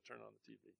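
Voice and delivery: hushed inner nudge to switch on the TV; little inner voice. Retold or imitated. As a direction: let it fade to a small inner voice. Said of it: "A little voice said".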